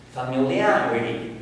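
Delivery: "Familiarity" with main stress on the AR syllable; shifted syllable stress. Shown as a demonstration. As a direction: hit the AR in "Familiarity". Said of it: "It's in different syllables".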